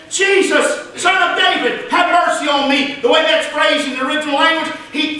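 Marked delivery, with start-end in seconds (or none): 0.1-3.0 high-pitched, imploring call; pleading cry. Acted. Blind man calling to passing Jesus, pleading cry. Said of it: "He began to plead and to cry out".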